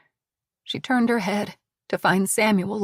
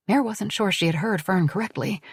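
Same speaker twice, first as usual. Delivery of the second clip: ordinary delivery, nothing marked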